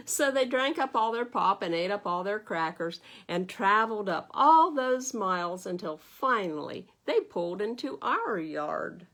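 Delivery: put-on expressive read-aloud to children; lively storybook narration for little listeners; story reading. A narrator's reading. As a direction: read it like a bedtime picture book. Reads picture book aloud.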